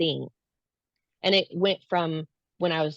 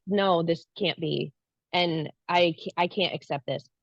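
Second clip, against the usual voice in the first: ordinary talk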